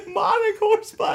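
high, loud, laughing cry to God; laughing shout. Retold or imitated. Quoting his plea to God about the horsefly, laughing and shouting. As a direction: shout it at God while cracking up. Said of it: "I'm like".

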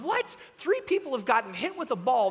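high, loud incredulous "what"; stunned bystander, played up; retold disbelief. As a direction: blurt it in mock shock. Retold shock at the chaos.

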